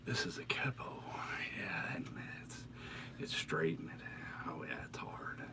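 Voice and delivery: soft whispered Bob Ross; breathy hushed painter whisper. Pretend. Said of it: "You gotta say it in a Bob Ross kind of voice".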